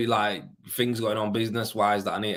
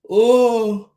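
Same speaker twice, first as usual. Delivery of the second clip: surprised oh; 13 semitones higher, 10 dB louder than usual. Real, not acted. Spots a name in chat.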